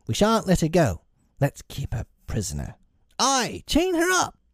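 put-on high-pitched boyish threats; threatening boys. A narrator's reading. Lost boys threatening to keep Wendy, rising to a high cry. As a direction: build to a high, menacing boy's cry. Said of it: "advanced upon her threateningly".

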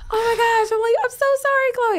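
very high, loud anguished cry; retold anguish. Owner finding her dog killed, crying out in grief.

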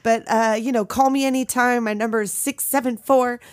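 lightly voiced Frank rambling on a machine, slowing on the number; panicked Frank message. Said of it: "I love it when he's like".

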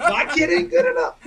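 high, loud mock outrage through laughter; laughing shout. Retelling mom's reaction to the coach, laughing shout. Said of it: "all fired up too".

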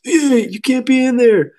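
high, loud, drawn-out yell; retold yell. Walmart workers yelling at the trespassing angler, loud high shout. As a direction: shout the workers' warning across the pond. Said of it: "standing there just yelling at us".

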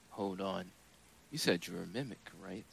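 ordinary delivery

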